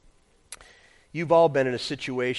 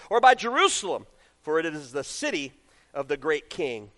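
loud, raised, emphatic Bible reading; scripture reading; 6 semitones higher, 7 dB louder than usual. Real, not acted. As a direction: proclaim the verses with weight.

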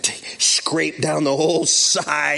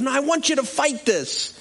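higher-pitched mock pleading; imitated insistence; 10 semitones higher than usual. Cop pressing pastor to fight, mock insistent.

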